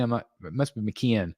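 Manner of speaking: usual speaking voice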